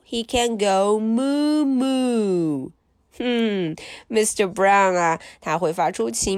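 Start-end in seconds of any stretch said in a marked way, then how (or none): 1.0-2.7 1 mooing cow. Pretend. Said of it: "He can sound like a cow".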